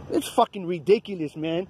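normal talking voice

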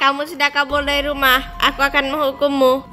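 low, stern robot threat; menacing machine voice. Acted. (suara robot yang mengancam)

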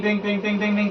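rhythmic bell-like ting sounds; imitated ting-ting jingle. Teacher building up to the quiz question, chanted ting-ting.

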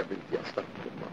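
whispered under the breath; whispered prayer. Acted. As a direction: murmur a prayer to yourself. Muttered prayer to himself. (همس بالاستغفار)

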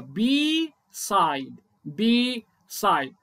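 بي سايد with a long بي at half pace; slow correct form. Shown as a demonstration. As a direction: draw out بي clearly. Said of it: "اوبن سيلابل". (بي سايد ببطء)

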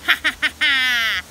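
loud, staccato acted cackle; witch's cackle. A narrator's reading. Wily witch laughing at the knight, cackling. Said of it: "cackled with laughter".

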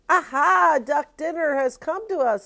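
loud, high, triumphant father; exclaiming father. A narrator's reading. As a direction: crow over the catch as the father. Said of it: "said the boy's father".

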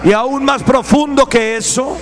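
ordinary delivery

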